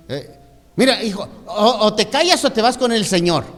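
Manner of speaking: loud, high stern telling-off; a parent snapping at a child to hush; mock angry mother warning her son; scolding parent.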